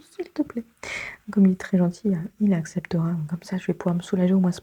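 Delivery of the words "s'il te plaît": petite voix aiguë et douce, implorante; demande suppliante. Pretend. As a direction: supplier d'une toute petite voix haut perchée. Imite sa demande au collègue. (petite voix suppliante)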